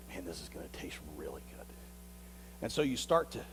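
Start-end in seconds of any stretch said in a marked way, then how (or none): 0.1-1.7 quick excited whisper of temptation; hushed whisper. Acted. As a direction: breathe it fast and secret, unvoiced. Sheep's inner thought while sniffing.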